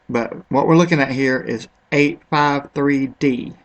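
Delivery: usual speaking voice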